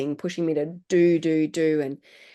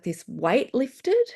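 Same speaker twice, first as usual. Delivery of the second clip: normal talking voice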